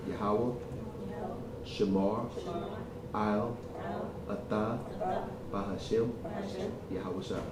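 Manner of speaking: soft, word by word with a pause after each; feed it out piece by piece for pupils; repeat-after-me teaching. Shown as a demonstration. Teacher has learners repeat the salute after him, word by word. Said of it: "repeat after me after, and when I say it".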